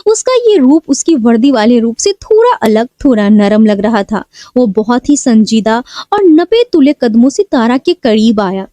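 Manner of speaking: usual speaking voice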